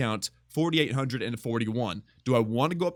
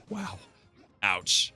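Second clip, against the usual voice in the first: ordinary talk, nothing marked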